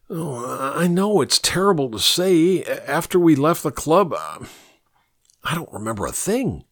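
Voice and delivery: regretful. A narrator's reading. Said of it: "The regret in Daryl's voice was evident and sincere".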